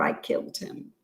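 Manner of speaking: breathless whispered confession. Acted. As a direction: drop to a bare whisper. Confession of the murder.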